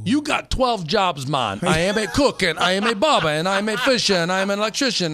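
high, loud comic voice; hammed-up sitcom accent; Damon Wayans impression. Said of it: "we've talked about your accents".

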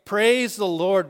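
loud, slow, drawn-out praise; retold shout. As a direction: stretch it out and call it loud. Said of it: "and he shouts out".

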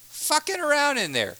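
high, exasperated repeat; exasperated scold. Acted. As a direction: throw it back at him in disbelief. Mock scolding Craig.

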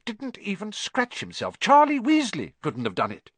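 high, eager teacher's boast; teacher bragging to the captain; proud praise. Acted. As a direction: boast about the new player. McGonagall praising Harry.

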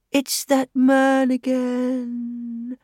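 very slow, moaning lament; drawn-out mournful moan. Acted. Scarecrow reaching out to the widow, mournful and slow. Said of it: "said in a mournful voice".

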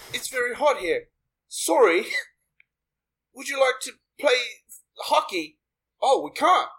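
put-on a would-be Canadian accent that comes out Australian; Australian-sounding Canadian accent. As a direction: play a Canadian but slide into Aussie, mate. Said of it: "did you think that you were in Australia".

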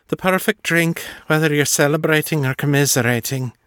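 stronger Irish lilt, savoring; Irish accent. Acted. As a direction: let the brogue creep in. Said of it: "a bit more of her accent creeping into her voice".

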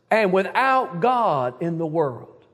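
slowed, weighty without God in the world; stress on without God. Shown as a demonstration. Preacher underscoring words for the church, slow stress. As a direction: press each word of "and without God in the world". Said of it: "and underscore these words".

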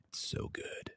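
breathy hush of pure pleasure; savoring whisper. Real, not acted. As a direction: whisper it like a secret treat.

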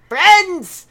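Friends called high and loud; loud proclaiming. Acted. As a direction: Friends shouted out like a herald. Calling out louder, take two.

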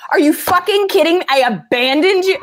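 indignant venting. Retold or imitated. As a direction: rant at the ex to a friend. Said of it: "this is a Marco Polo to a friend".

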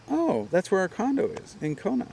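high, lilting note of discovery; light up at the coincidence, retold; pleasant surprise. Stranger hearing about Kona, mildly acted surprise.

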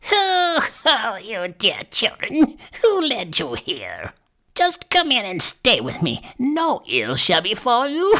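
high, slow, drawn-out crone voice; old witch. A narrator's reading. Old woman invites dear children inside, crone voice. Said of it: "But the old woman shook her head and said".

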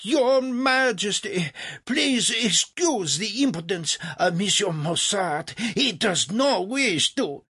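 high, slow, anxious apology; mortified courtier. Acted. Legrand apologizing to the king for Mozart, mortified. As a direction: rush to smooth it over, panicking. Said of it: "A mortified Legrand jumped in".

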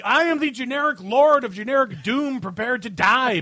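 pompous, booming threat of doom; generic doom boss. Acted. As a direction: declaim it like a hammy overlord. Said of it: "These voices are all done by Chris Metzen".

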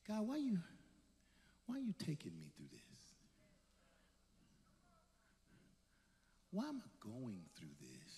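very soft, low, private questioning of God; hushed plaintive prayer. Acted. Believer asking God why they must suffer, hushed and plaintive. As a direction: sink toward a near-whisper, as if praying alone.

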